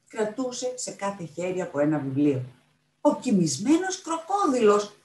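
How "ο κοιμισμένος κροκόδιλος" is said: slow, high, loud exclamation; astonished shout. A narrator's reading. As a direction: cry out at the surprise discovery. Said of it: "φώναξε η Μάρθα Ρουθ".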